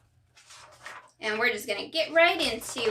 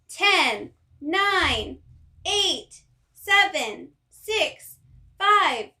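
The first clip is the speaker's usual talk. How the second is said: excited countdown; 5 semitones higher, much slower than usual. Acted. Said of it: "Everyone counts backwards together".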